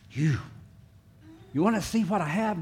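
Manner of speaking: lightly voiced coaxing God. God coaxing people closer, light and inviting. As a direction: invite them in like a pet. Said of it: "God says".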